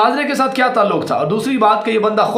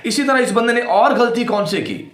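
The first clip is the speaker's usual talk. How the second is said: usual speaking voice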